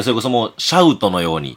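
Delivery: ordinary delivery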